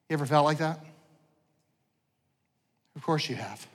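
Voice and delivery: ordinary delivery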